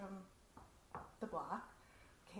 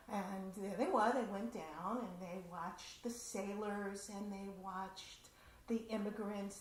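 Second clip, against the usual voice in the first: normal talking voice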